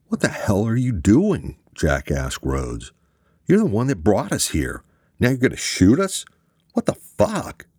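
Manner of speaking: incredulous anger climbing to a high shout; furious disbelief, voice breaking up high; outraged. A narrator's reading. Jack yells at Rhodes pointing gun, outraged shout.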